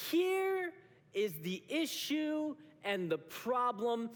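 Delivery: slow, deliberate, drawn-out words; God pointing it out. Acted. God answers, pointing to your workplace, slow and deliberate.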